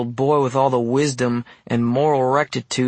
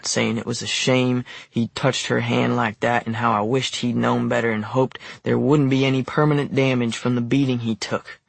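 usual speaking voice

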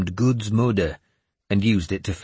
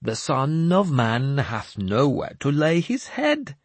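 mysterious; 6 semitones higher, slower than usual. Acted. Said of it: "Jeremias said mysteriously".

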